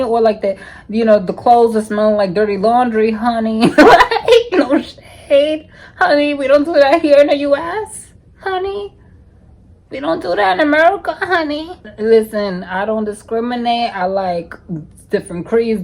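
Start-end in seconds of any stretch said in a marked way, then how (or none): 4.3-11.7 high, sassy diva roast; roasting European guys, high and sassy; sassy no shade. Real, not acted.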